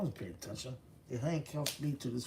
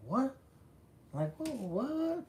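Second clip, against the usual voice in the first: high-pitched, incredulous "what"; puzzled yelp; startled retelling; 14 semitones higher, slower than usual. Retold or imitated. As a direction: re-enact his own baffled reaction, voice jumping up. Retold startled reaction to news.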